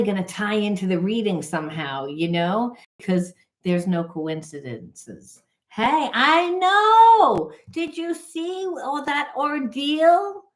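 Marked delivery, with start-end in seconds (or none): 5.7-10.4 high, drawn-out exclamation; animated greeting. Real, not acted. Streamer greets a viewer after the sound ordeal, animated. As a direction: greet them high-pitched, stretching the words.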